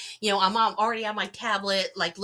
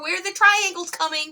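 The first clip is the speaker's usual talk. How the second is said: high, a bit louder, urgent; pressing the witness on the phone, high and urgent; alarmed question; 10 semitones higher, 5 dB louder than usual. Retold or imitated.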